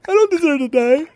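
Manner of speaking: high, desperate plea; boy pleading he doesn't deserve death; terrified begging. Retold or imitated. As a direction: wail it like a scared kid. Kid begging for life.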